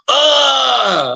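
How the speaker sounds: high startled shout; startled yelp. Retold or imitated. Freddie hiding in the closet, startled yelp. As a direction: yelp like someone just found your hiding spot. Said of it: "He goes".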